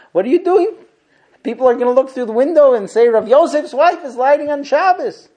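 building from firm to a loud, high furious rant; ranting husband. Retold or imitated. Average husband scolding his wife over late candles, rising angry rant. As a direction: scold her harder with every question until you're yelling. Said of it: "what does the average husband do?".

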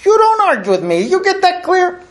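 hammed-up tough gal, rising high at the end; a mock warning not to argue; tough southern wife. The man imitates his armed wife warning him off. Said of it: "she pulls out her six-pack and goes".

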